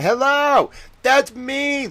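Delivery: excited greeting. Acted. Streamer voicing towel persona greeting.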